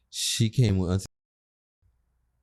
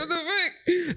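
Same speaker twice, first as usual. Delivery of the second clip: alarmed; slower than usual. Retold or imitated. Panicked passenger in speeding car.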